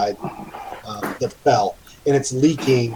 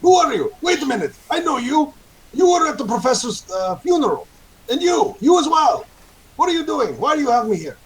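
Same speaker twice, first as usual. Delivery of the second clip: much higher, frantic and rattled; a tied-up thug pleading in a high, scared voice; panicked captive. Acted. Bound captive panics, recognizes the heroes.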